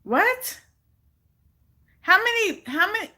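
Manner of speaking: very high incredulous squeak; disbelief. Real, not acted. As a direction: shoot up in pitch, stunned. Baffled by absurd number.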